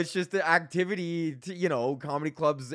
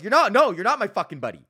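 loud, much higher indignant yell; angry outburst; 6 semitones higher, 9 dB louder, faster than usual. Real, not acted. Yelling at a grocery boss on TV, angry and loud. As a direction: shout it back at the TV ad. Ranting back at fake-friendly ads.

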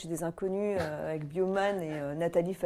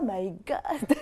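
mock American amazement; excessive American gushing; 2 semitones higher than usual. Actress mimicking Americans' reaction to her, excessive gushing. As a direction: imitate over-the-top American enthusiasm. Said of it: "ils sont excessifs et tout est amazing".

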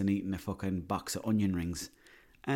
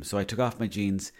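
usual speaking voice

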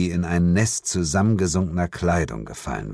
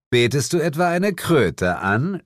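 teasing; 8 semitones higher than usual. Acted. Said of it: "stichelte ich".

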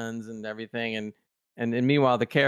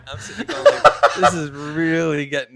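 real laughter, much louder; laughing; 8 dB louder than usual. Real, not acted. Host laughing hard, telling cohost about the scene. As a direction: gasp it out between laughs.

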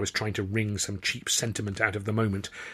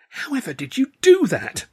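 very high, loud astonishment; amazed cry; 14 semitones higher, 7 dB louder than usual. A narrator's reading. Fellow comic asking about the dove trick, crying out. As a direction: cry out in high-pitched wonder. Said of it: "Stan cried".

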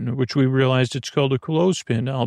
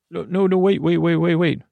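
urgent interruption; 4 semitones higher, faster than usual. Acted. Hiccup sufferer interrupts the treatment.

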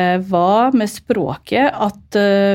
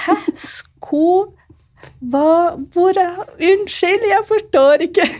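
high, baffled stammer; new bride who can't follow the dialect, confused; 11 semitones higher, slower than usual. Retold or imitated. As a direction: stumble over the words, lost. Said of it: "tenkte jeg".

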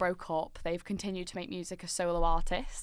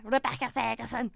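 mock Scouse, higher pitched; Scouse accent; 4 semitones higher than usual. Podcaster imitating a Scouse singer's name, playful Scouse accent. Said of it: "who's a Scouser".

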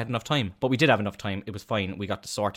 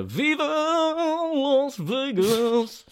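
excited [name]; 4 dB louder, much slower than usual. Retold or imitated. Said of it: "I was like".

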